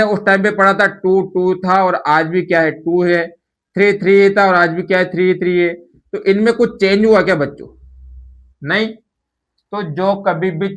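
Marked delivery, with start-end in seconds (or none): none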